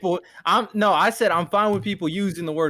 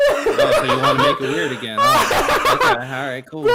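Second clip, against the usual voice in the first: laughing; 3 dB louder than usual. Real, not acted. Laughing at friend's teasing joke.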